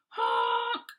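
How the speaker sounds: high loud frightened honk; loud scared high honk. Acted. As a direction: honk for help in a panic. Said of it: "he sounded scared".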